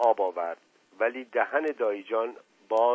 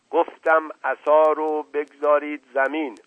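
loud, higher, insistent order; commanding tone; 6 semitones higher, 7 dB louder than usual. A narrator's reading. Uncle ordering woman to drop cane, commanding. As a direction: repeat it with full authority. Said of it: "لحن تحکم دایی جان".

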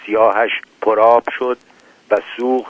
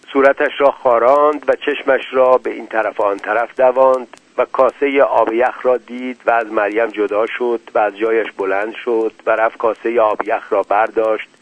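ordinary delivery, nothing marked

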